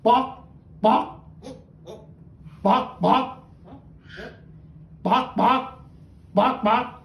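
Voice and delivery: chicken imitation. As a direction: squawk like a chicken. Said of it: "the Academy said".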